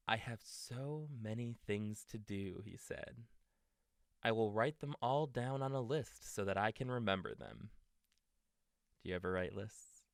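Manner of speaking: gentle storybook read-aloud; reading to children. A narrator's reading. Parent reading a bedtime story to a child, gentle.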